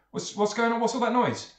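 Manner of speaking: demanding to know what the racket is; startled sailor. Acted. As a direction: call out as a sailor checking on a commotion. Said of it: "comes down and says".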